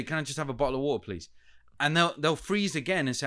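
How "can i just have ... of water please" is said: fast British accent, words clipped; rapid British accent. Shown as a demonstration. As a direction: rattle it off British style. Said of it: "And I say".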